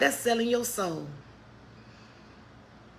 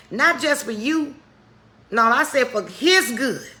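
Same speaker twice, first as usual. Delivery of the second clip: louder, rising sermon push; fired-up preaching; 5 dB louder than usual. Acted. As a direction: preach it out hard to the congregation. Preaching at full force.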